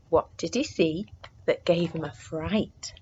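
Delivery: ordinary delivery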